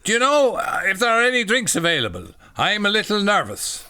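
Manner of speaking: high, loud mock Irish accent; badly faked Irish lady. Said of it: "you can't do an Irish accent".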